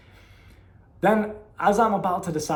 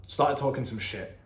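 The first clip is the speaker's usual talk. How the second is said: ordinary delivery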